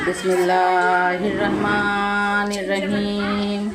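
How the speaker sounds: slow, low, drawn-out Arabic recitation; Quran recitation. Acted. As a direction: intone the verses slowly and low. (धीमा, गहरा क़ुरआनी तिलावत का स्वर)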